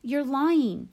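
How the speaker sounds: high, loud outburst; heated child's yell; screamed accusation. A narrator's reading. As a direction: scream it at your sister, pitch jumping up. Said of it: "I screamed at Via".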